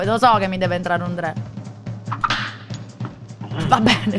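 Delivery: ordinary delivery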